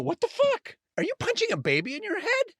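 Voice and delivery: imitating a friend's shocked disbelief; shocked outburst. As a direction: play the friend catching you out, aghast. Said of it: "turn to me and go".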